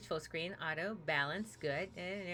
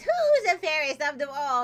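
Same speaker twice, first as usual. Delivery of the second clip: put-on vain old hag; 5 semitones higher, 7 dB louder than usual. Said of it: "Old Hag goes to mirror and says".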